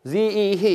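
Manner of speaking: 지, 이, 히 as soft open syllables; old letter names. Shown as a demonstration. Teacher showing how these letters were once read, 지, 이, 히 softly left open. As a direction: list 지, 이, 히 with no final consonant. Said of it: "이렇게 읽었어요".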